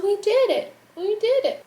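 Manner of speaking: high, bright cheer of triumph; excited. Real, not acted. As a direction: celebrate like you just won. Celebrating release from quarantine.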